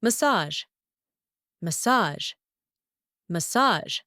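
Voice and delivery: "Massage" ending in a soft zh; soft French ending. Shown as a demonstration. Teacher showing a borrowed French word, soft zh ending. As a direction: stress the second syllable of "Massage", finish on zh. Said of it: "the end of the word is more softer".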